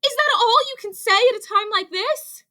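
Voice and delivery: high, fast, exasperated outburst; frantic cry. A narrator's reading. Rabbit scolding Ribbit in the crisis, frantic. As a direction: cry it out in a panic. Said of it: "cried Rabbit".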